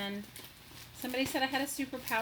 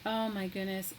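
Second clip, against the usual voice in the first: normal talking voice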